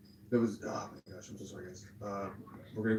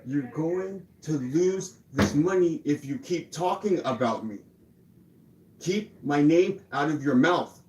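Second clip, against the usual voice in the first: slow, loud, raised-pitch threat; angry warning; 5 semitones higher, 6 dB louder, much slower than usual. Real, not acted. Streamer warning someone to stop talking about them, slow angry threat. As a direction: spell it out word by word, furious.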